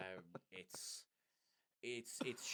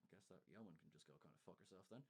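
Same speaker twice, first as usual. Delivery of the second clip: quiet aside; 5 semitones lower, 15 dB quieter, faster than usual. Real, not acted. Sarcastic aside.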